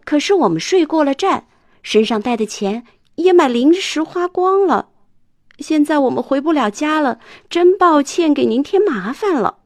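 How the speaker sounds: high, loud rush of explanation; hurried pleading. Acted. Arthur hastily explaining to the bus driver, eager pleading. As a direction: blurt it all out to the driver. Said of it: "亚瑟赶忙说".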